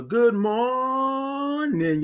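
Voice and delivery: slow, high stretched "Good morning"; singsong stretched "Good morning"; drawn-out good morning. Acted. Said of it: "drawn greeting".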